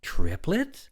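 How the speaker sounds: high, loud astonished question; exclaiming. A narrator's reading. Woman reacts to news of triplets, astonished. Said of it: "She was exclaiming".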